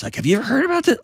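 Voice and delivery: high, fast eager ask; excited curious question. Pretend. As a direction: toss it out quick and bright, curious.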